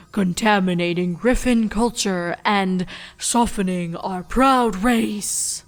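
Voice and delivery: lightly mocking an old griffin chancellor's raspy baritone, slowing at the end; a hint of a stuffy old bird grumbling; parody of raspy baritone. A narrator's reading. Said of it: "in a parody of the Chancellor's raspy baritone".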